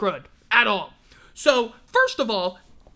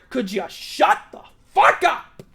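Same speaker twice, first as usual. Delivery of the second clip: louder, fed up snap at the noise outside; angry at the siren; 4 dB louder than usual. Real, not acted. Yelling at a siren outside, annoyed.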